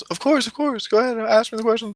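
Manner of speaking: put-on high, rushed kid voice; eager young boy. Billy eagerly agreeing to the clown's questions, eager. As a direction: imitate a child bursting to answer. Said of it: "And Billy goes".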